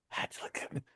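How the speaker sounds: barely voiced whisper. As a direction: hush it under your breath. Retold thought whispered.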